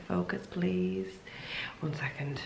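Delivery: soft whispered aside. Real, not acted. Waiting for the camera to focus, whispering. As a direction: whisper it off to the side.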